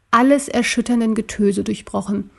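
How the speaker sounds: plain reading voice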